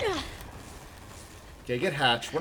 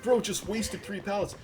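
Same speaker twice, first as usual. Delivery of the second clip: mocking commenter; 7 semitones higher than usual. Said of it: "People have been leaving comments".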